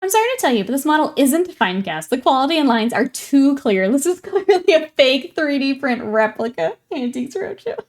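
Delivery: hammed-up bad news with a laugh breaking in; mock appraiser. Jokingly acts a Roadshow appraiser's verdict.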